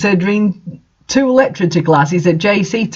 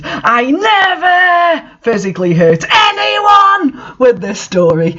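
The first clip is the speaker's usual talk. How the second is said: indignant outburst; 8 semitones higher, slower than usual. Real, not acted. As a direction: cry out the denial. Author rejecting accusation of hurting.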